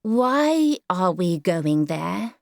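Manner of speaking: a lightly voiced weary small boy; sleepy child, drawn out; tired little boy. Small boy Howard asks.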